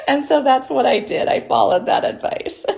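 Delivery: words spilling through a real laugh. Real, not acted. Host laughs while recalling her husband's advice. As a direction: laugh your way through the line.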